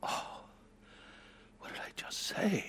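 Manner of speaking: hushed, horrified realization; barely voiced, appalled at yourself; stricken whisper. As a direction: whisper it as if caught by shame. Stunned regret at his own words.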